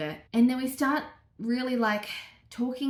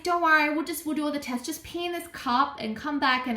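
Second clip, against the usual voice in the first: lightly imitated doctor, higher voice; doctor's breezy reassurance; 6 semitones higher than usual. Doctor sending a patient off for tests, lightly mimicked reassurance. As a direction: retell it as an easygoing, higher-pitched doctor.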